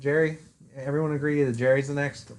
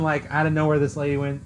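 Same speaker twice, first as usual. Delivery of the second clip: ordinary talk, nothing marked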